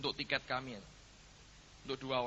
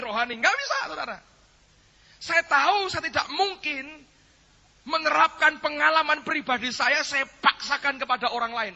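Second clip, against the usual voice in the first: loud, raised-pitch sermon delivery; full-force preaching; 12 semitones higher, 11 dB louder than usual. Real, not acted. Preacher explaining his experience to congregation, forceful preaching. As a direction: drive each point home to the congregation.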